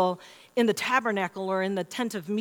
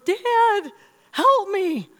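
high, loud mock cry for Dad; child crying out; 10 semitones higher, 6 dB louder, much slower than usual. Frightened daughter calls to dad from her room, high imitated cry. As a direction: imitate a scared little girl calling out. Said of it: "she cried from her".

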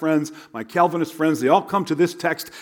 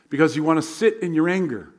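ordinary talk

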